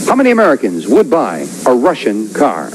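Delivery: announcer reading the survey question; host voice posing the question; game show host. Acted.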